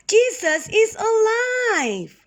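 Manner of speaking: very high, ringing joyful cry. Acted. Women running into the room to tell the helpers, a joyful cry. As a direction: burst in shouting the good news. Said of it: "cried the women".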